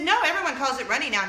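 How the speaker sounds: mock indignant mom; insistent retort. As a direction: brush off the daughter's correction. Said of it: "I said".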